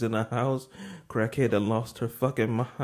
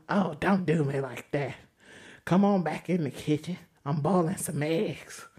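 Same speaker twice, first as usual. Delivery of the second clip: higher, put-on voice; put-on host voice; 9 semitones higher than usual. The host woman inviting them to the kitchen, high put-on voice. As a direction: coax them back into the kitchen.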